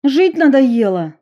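high loud sharp retort; sharp question. A narrator's reading. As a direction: snap the question. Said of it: "резко спросила Стелла".

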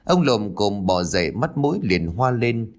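ordinary delivery

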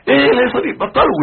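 high, loud mock outburst; acted angry yelling. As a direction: play an exasperated father yelling at the household. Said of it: "وتزعق أنت كمان".

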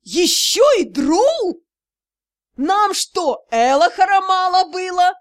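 loud, high, outraged cry; flaring up. A narrator's reading. Mother-in-law flaring up at the news, loud and high. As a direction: flare up in outrage. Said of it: "леди Тьер взвилась".

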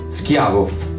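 plain reading voice

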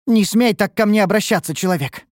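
sharp offence, pitch shooting up; angered. A narrator's reading. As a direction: rebuke him angrily. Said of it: "разозлилась Амаранте".

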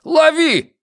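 very high, urgent shout; short shouted order; yelling. A narrator's reading. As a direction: shout the command at full pitch. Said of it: "заорал Трэвису Пратт".

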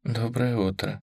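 barely audible whisper. Acted. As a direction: breathe it almost silently. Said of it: "чуть слышно ответила она".

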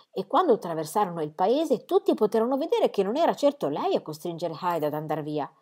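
ordinary delivery

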